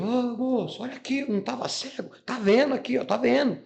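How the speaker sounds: excited calling. Retold or imitated. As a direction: call out to the man in delight. Mother shows off healed son. (mãe chamando o moço, empolgada)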